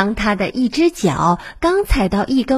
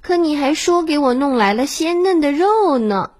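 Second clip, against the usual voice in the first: higher, reproachful quoting, stress on 肉; complaining; 6 semitones higher than usual. A narrator's reading. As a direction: throw the mother's words back at her. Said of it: "女儿诉苦道". (女儿责怪地诉苦)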